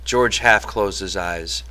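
usual speaking voice

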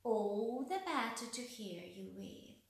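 ordinary delivery, nothing marked

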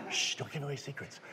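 very soft secretive hush; conspiratorial shush. Retold or imitated. Magician shushing the insistent actress, conspiratorial. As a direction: keep it between you two, hushed.

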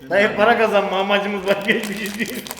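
laughing while talking. Real, not acted. Joking about money.

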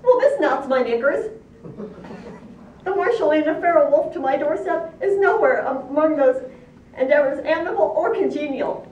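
high, loud indignant pig; cry of dismay. A narrator's reading. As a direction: scold loudly in high-pitched dismay. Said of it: "cry out in dismay".

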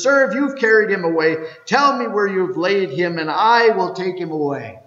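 loud, high pleading. Acted. Mary begs the gardener to say where he is, loud pleading. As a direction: beg him to tell you.

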